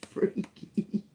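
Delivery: whispered, breath-only echo; whispered aside. Real, not acted. Reader reacting to world news, laughing whisper. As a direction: whisper it after a little laugh. Repeats freaky after laughing.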